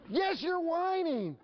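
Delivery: very high-pitched, drawn-out retort; hammed-up parent losing it at a whiny kid; exasperated parent. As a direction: shoot up high and stretch the accusation. Parent and child quarrel escalates.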